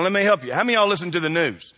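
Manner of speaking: ordinary talk, nothing marked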